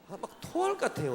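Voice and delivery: disgusted exclamation. Real, not acted. Disgust at smooth talkers.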